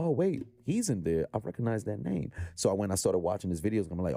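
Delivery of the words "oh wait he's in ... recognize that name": higher-pitched dawning realization; sudden recognition. Retold or imitated. Guest retelling spotting a familiar name, surprised recognition. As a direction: act out suddenly placing a name.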